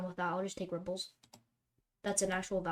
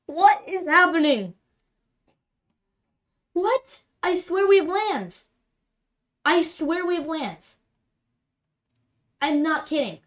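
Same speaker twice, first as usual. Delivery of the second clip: much higher and louder, slowed disbelieving shouts; exasperated yelling; 8 semitones higher, 13 dB louder, slower than usual. Real, not acted. Gamer yelling at the screen over missing lands, exasperated. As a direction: scream your frustration at the game.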